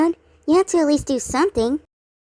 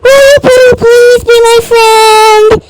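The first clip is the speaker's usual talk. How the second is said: high, loud, slow begging; shouted sugary plea; loud pleading; 10 semitones higher, 12 dB louder, much slower than usual. Acted. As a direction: stretch out the plea at full volume. Pleading with reluctant Maroon.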